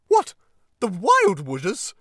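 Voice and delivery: high, loud cry of alarm; horrified cry. A narrator's reading. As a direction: cry out aghast. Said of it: "cried Toad, trembling in every limb".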